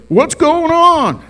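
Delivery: high, loud and drawn out, slow and puzzled; Keith, confused. Retold or imitated. Pastor imitating Keith waking up confused, high and loud. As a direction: stretch it out like a dazed old fisherman.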